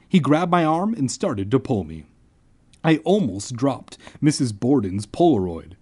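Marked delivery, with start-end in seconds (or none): none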